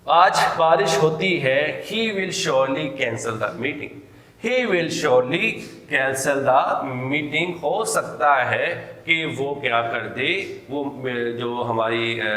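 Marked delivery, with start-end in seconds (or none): 1.9-7.5 he will surely cancel the meeting, word by word, very slow; slow dictation. Shown as a demonstration. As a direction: read it out slowly for students to copy. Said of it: "teacher dictating example sentence". (آہستہ آہستہ لکھوانے کے انداز میں)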